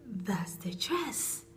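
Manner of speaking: slow, hushed, awed whisper; a slow whispered that's the dress; breathy awe. Pretend. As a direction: breathe it out slowly, dazzled. Imagined moment finding the dress.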